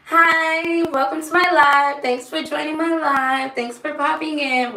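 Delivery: bright high welcome to viewers; cheery greeting voice. Retold or imitated. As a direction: greet newcomers, upbeat and high. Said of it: "I go".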